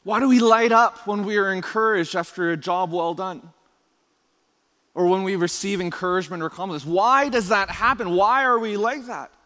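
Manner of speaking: ordinary delivery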